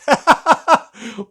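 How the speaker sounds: high, loud burst of laughter; delighted laugh. Real, not acted. Seeing the painted city, a loud delighted laugh. Reacting to the canvas reveal.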